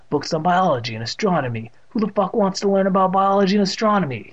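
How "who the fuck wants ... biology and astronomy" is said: higher, louder irritated grumble; irritated thought. A narrator's reading. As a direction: scoff it in exasperation.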